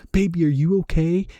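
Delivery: high, loud, worried question; freaking-out girlfriend. Retold or imitated. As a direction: imitate a panicked girlfriend checking on her partner. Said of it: "still freaking the fuck out".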